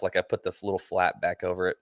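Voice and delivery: normal talking voice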